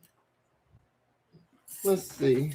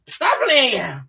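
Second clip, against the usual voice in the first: normal talking voice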